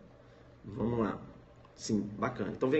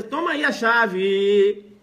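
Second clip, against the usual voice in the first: higher, louder, slow and easygoing; trusting driver; 4 semitones higher, 7 dB louder, much slower than usual. Acted. Driver handing car key to fake valet, higher and relaxed. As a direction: toss him the key, totally unsuspecting.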